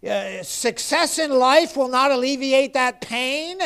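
high, driving pulpit cadence; impassioned preacher in full flow; fervent preaching. Real, not acted. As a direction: hammer each repeated phrase, building to a loud peak.

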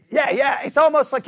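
ordinary delivery

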